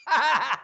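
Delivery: high burst of laughter. Real, not acted. Host jokes with man in earpiece, laughing. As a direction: crack up out loud.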